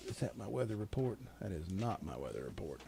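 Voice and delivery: low, soft, to yourself; talking to himself. Real, not acted. Radio host hunting for his weather report, talking to himself.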